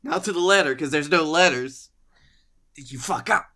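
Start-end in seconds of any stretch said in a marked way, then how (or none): none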